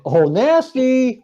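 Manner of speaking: very drawn-out, high-pitched jeer; sing-out mock horror, slowly; teasing, stretched and high; mock dismay. Teasing about an opponent's schedule.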